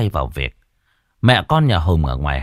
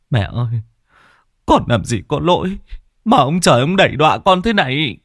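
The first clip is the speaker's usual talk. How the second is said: blubbering; 4 semitones higher, 3 dB louder than usual. Acted. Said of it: "mà mếu máo".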